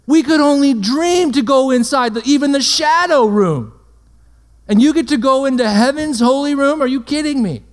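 mock outrage from a saint who could only dream of it; incredulous Old Testament saint. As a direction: play an ancient believer stunned at how easy we have it. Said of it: "They'd look at us like".

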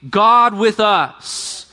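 slow, drawn-out proclamation; exclaimed. Shown as a demonstration. Pastor urging the church to cry out, slow exclamation. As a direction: proclaim each word slowly. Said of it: "we ourselves exclaim".

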